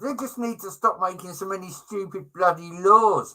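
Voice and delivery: ordinary delivery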